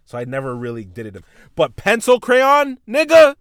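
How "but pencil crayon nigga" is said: higher and louder, drawn out slowly; slow, loud punchline; incredulous exclamation. Real, not acted. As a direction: hit the reveal big and slow. Punchline of realizing his own dumbness.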